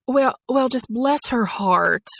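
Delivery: sympathetic Southern accent, stretched out at the end. Shown as a demonstration. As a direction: bless them in a Southern US accent. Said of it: "like, a Southern accent".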